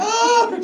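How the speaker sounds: high, loud mock child's wail; imitated crying. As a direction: wail like a crying baby, hammed up. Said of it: "they're crying".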